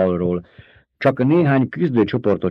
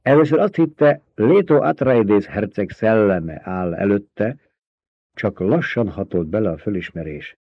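plain reading voice

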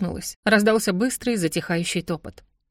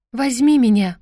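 louder, a little embarrassed; a bit louder; 4 semitones higher, 6 dB louder than usual. Acted. Heroine forcing out the spell, a bit louder. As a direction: say it louder, blushing. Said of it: "сказала я чуть громче".

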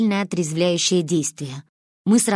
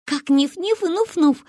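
put-on high-pitched giggly girl's voice; acted child voice, pitched way up; Манька; 9 semitones higher than usual. A narrator's reading. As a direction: chirp it high like a little girl having fun. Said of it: "захихикала Манька".

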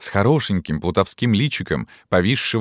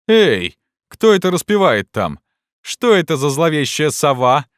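jeering challenge; 8 semitones higher, 5 dB louder than usual. A narrator's reading. Students pounce on the burgher.

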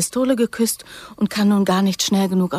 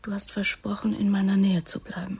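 softer pleading whisper; 6 dB quieter than usual. Acted. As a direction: remind him in a whisper. Clinging plea.

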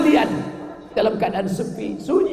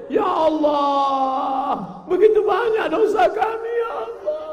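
hammed-up weeping plea, much higher; mock tearful prayer; 11 semitones higher, slower than usual. Preacher imitates the zikir leader's crying plea to God.

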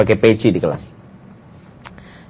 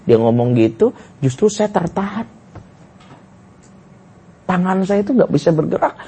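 usual speaking voice

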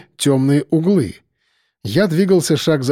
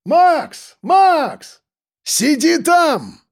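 shouting; 13 semitones higher, much slower than usual. A narrator's reading. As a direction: yell to him to stay put. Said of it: "крикнул я".

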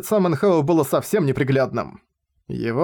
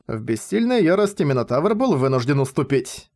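ordinary delivery

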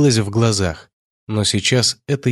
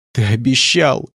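crying out, hurt and tearful; sobbing; 2 semitones higher than usual. A narrator's reading. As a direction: exclaim it while sobbing with hurt. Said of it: "с обидой уже рыдая воскликнула Диана".

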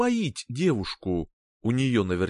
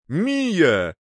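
shout; 3 semitones higher, 4 dB louder than usual. Acted. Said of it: "крикнул Альтер".

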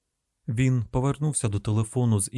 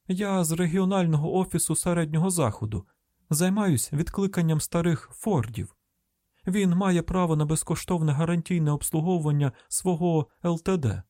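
higher, polite phone pitch; official-sounding caller; 6 semitones higher than usual. Acted. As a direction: explain your business in a lifted voice.

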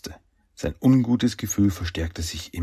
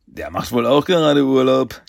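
giggly, higher and louder; kichernd; 5 semitones higher, 6 dB louder than usual. A narrator's reading. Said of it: "kicherte Raphael". (kichernd gesprochen)

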